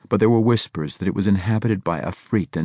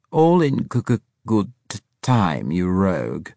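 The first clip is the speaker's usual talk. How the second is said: put-on stuttering old rogue; 3 semitones higher, 4 dB louder than usual. A narrator's reading. Braithwaite teasing the Scorpion about timing, coy stutter. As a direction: stutter coyly, then settle on the tease.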